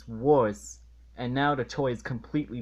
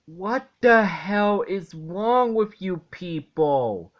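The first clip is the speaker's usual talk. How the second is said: exasperated rant; 5 semitones higher, 5 dB louder than usual. Real, not acted. Reviewer ranting at the show's writers, loud and outraged. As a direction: vent at the writers. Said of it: "ranting at the writers".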